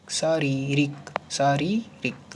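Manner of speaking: शारीरिक drawn out syllable by syllable; slow dictation. Shown as a demonstration. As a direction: spell it out slowly for the class. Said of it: "अगला शब्द है शारीरिक".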